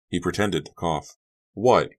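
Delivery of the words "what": testy. A narrator's reading. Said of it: "Carrie asked testily".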